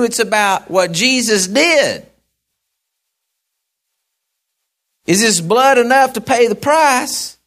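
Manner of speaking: loud, high, fervent preaching; full-force preaching. Real, not acted. Preacher rousing the church about Christ's sacrifice, full force. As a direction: preach it out to the congregation.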